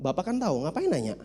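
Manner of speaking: low, soft, unbothered backtalk; calm cheeky answer to the principal; cool nonchalant reply. Retold or imitated. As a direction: shrug it off softly, like nothing matters. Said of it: "Saya cuman bilang".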